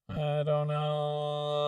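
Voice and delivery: slow auto-tuned phrase; snappy auto-tune. Shown as a demonstration. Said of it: "super snappy effect".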